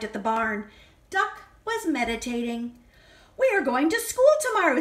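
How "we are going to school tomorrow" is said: higher, fast and louder; excited announcement. Acted. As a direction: rush the news to Duck. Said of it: "said Farmer Brown".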